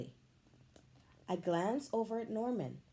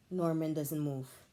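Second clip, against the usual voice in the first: ordinary delivery, nothing marked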